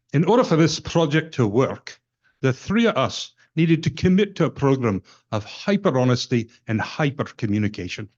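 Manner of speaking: imitating a Scottish presenter's brogue, not overdone; Scottish accent. Quoting a Scottish presenter to the shareholders, light Scottish accent. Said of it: "I'll do my best not to butcher the Scottish brogue too much".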